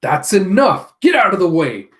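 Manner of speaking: a character shouting at enemies. Acted. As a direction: bark it at the soldiers. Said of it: "Yamada going".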